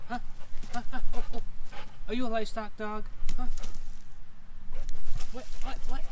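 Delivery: high teasing question to the dog; pet talk. Real, not acted. Teasing Maverick if he's a livestock dog, high pet voice. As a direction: tease up high like asking a puppy.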